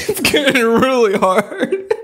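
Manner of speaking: slow, high, laughing strain. Real, not acted. Player struggling to get the drink down, strained laughing.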